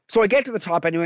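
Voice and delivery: ordinary talk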